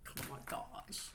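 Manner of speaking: unvoiced, awed whisper. Real, not acted. Reader whispers to viewers, overcome by the message, awed whisper. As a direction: whisper it in awe. Overwhelmed by the spirit's words.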